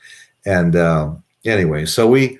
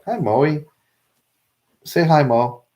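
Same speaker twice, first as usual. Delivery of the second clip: high sweet pet-talk voice; talking to the dog; 10 semitones higher, slower than usual. Real, not acted. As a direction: coo at a tiny pup. Talking to his small dog.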